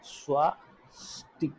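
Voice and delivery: ordinary delivery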